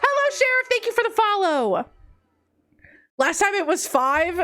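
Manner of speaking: ordinary talk, nothing marked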